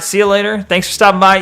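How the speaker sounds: ordinary talk, nothing marked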